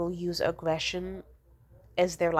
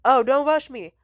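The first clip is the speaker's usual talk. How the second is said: louder, higher imitation of an irritated mom; annoyed brush-off; 6 semitones higher, 8 dB louder than usual. Mom answering daughter's laundry reminder, annoyed imitation. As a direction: brush the request off crossly. Acts out mom's first annoyed reply.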